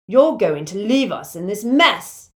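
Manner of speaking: demanding. A narrator's reading. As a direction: demand it, punching the words out. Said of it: "demanded Michael Corner".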